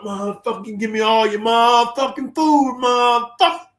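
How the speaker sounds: high, loud acted threat; menacing demand. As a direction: play a prison bully demanding food. Acted threat demanding someone's food.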